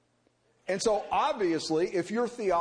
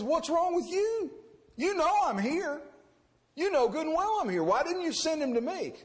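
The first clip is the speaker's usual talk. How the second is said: much higher, indignant rebuke; scolding prophet; 8 semitones higher than usual. Retold or imitated. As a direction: scold him like a prophet who was ignored. Elisha scolds the king.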